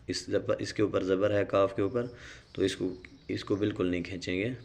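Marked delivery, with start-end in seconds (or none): none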